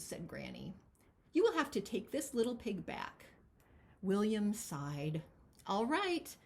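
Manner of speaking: lively picture-book read-aloud; expressive storybook narration; reading to children. Acted. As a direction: read it as if to a room of kids.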